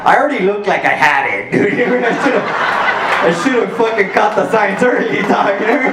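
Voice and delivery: can't hold back a laugh at his own joke. Real, not acted. To a friend, joking he already looked sick, laughing.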